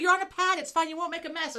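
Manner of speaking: high, bright mock nurse, laughing through it; a lightly voiced nurse, breaking into laughter; chirpy nurse impression. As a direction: play the pushy nurse, pitched well up. Imitating the insistent nurse, laughing.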